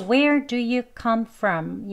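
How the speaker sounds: slow, separate "Where do you come from"; drawn-out citation "Where do you come from"; careful full form. Shown as a demonstration. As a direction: pronounce every word of "Where do you come from" fully. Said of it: "두 번째 예문입니다".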